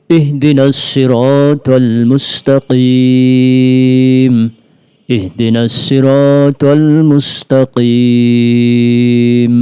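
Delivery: slow melodic tajweed chant; Quran recitation. Acted. Teacher recites the opening verses, slow melodic chant. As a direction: intone each verse, holding the long vowels.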